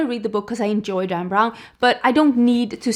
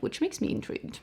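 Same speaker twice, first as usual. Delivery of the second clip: breathy whisper, much softer; whispered aside; 9 semitones higher, 10 dB quieter than usual. Real, not acted. Telling viewers the novel intrigues her, whispered. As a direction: drop to a whisper to tease intrigue. Soft aside after reading the blurb.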